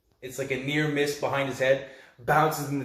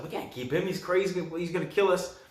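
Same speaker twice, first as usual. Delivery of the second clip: higher-pitched mock worry; alarmed protest; 6 semitones higher than usual. Brother to sister about the monster, mildly panicked. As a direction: imitate an anxious brother objecting.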